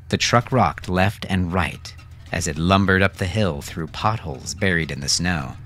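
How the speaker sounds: ordinary delivery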